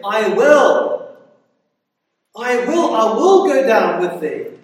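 loud, ringing repeated I will; emphatic promise. Acted. Preacher thundering God's promises to Jacob, loud and emphatic. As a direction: declare each I will with full force. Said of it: "this is emphatic".

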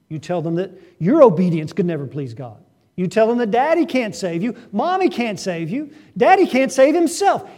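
rising to a loud, high preaching climax; preaching at full force. Acted. Preacher driving the point home to parents, loud and high.

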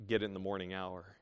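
normal talking voice

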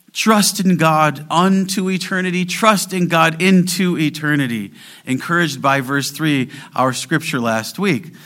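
rising fervent sermon climax; preaching at full force. Acted. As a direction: press the call to trust home from the pulpit.

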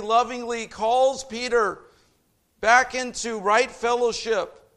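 loud, high, ringing sermon climax; fervent loud preaching; preaching at full force. Acted. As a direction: preach it out to the back row.

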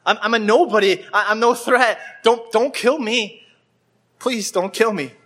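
high, pleading voice, slowing on kill; a cripple begging the king's soldiers, high and afraid; frightened pleading. Acted. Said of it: "he just cries out".

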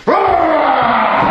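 a big holler straight at the sky; hollering shout. Retold or imitated. Recalling his own prayer, a loud high holler. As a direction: burst out with a high, loud preacher's rah. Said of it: "Screaming and hollering".

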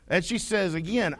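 ordinary talk

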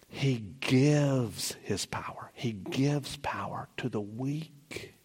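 whispered, slow and intimate; hushed reverent whisper, slow. Acted. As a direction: breathe the promise out slowly. Whispered scripture line.

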